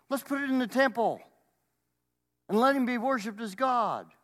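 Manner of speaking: very high, loud crowd voice; hammed-up enthusiasm. Crowd planning to put image in the temple, mock enthusiasm. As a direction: pitch it up as the crowd's bright idea.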